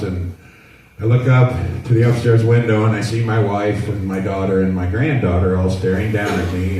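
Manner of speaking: ordinary delivery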